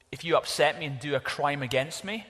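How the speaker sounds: ordinary talk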